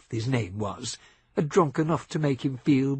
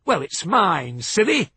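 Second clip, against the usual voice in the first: higher, louder mock-childish taunt; 6 semitones higher, 6 dB louder than usual. A narrator's reading. Seth mocking Billy Joe over the glass, sneering. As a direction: sneer it at him. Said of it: "Seth tried to mock him".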